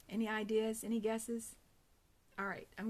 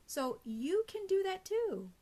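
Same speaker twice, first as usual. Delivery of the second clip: bright encouraging lift; 9 semitones higher, 3 dB louder than usual. Real, not acted. Encouraging the audience to smile.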